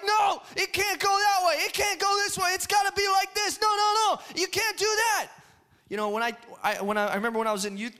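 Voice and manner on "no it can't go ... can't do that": high, frantic mock yelling; screaming. People with zero control screaming how it must go. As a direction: yell in protest, pitched way up. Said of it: "we are screaming".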